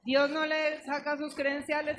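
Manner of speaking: normal talking voice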